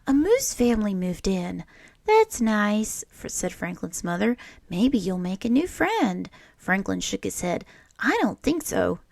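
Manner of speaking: put-on lively read-aloud voice for children; storybook reading. A narrator's reading. Teacher reading a picture book to children, lively storytelling. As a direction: read it like story time for a class.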